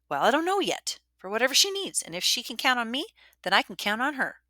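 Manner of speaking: a touch of pompous insistence. A narrator's reading. Ida explaining her plan to another girl, mildly pompous. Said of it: "sounding even more pompous".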